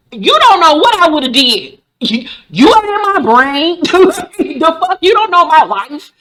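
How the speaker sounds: loud, high-pitched acted outrage; sassy clapback breaking into laughter; indignant retort. [name] acted snapping back at June.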